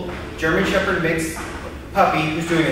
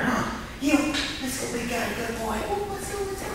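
high coaxing call; 7 semitones higher, 9 dB quieter than usual. Real, not acted. Coaxing dog to walk.

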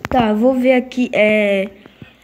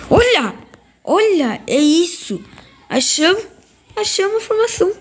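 excited; 6 semitones higher than usual. Real, not acted. Encontra a formação certa.

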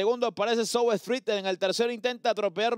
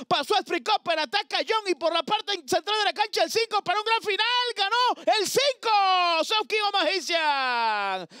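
building excitement; 8 semitones higher than usual. Real, not acted. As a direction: build to a shouted winner. Close finish building up.

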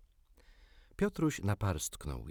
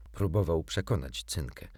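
plain reading voice

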